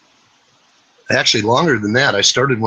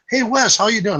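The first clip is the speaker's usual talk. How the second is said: cheery classmate greeting; 10 semitones higher than usual. Retold or imitated. Old classmate greets him.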